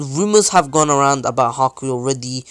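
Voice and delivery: plain reading voice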